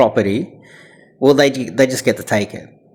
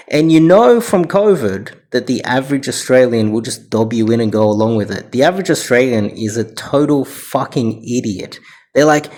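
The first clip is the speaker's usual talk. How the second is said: ordinary talk, nothing marked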